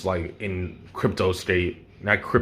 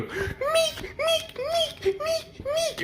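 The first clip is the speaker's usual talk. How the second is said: very high, softer squeaked impacts; high squeaky hit noise; 4 dB quieter, much slower than usual. Retold or imitated. Storyteller imitating Deku's blows landing, high squeaky hit sounds. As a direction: squeak each punch landing. These blows aren't those light little.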